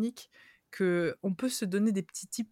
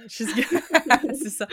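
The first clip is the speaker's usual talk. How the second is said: laughing through the words; 5 semitones higher, 8 dB louder than usual. Real, not acted. Host laughing at her own joke. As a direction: giggle while getting it out.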